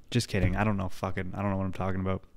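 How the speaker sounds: quiet retreat. Real, not acted. Backs off his prediction.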